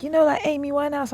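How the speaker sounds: high, mimicking voice; imitated doubters. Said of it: "And then they're like".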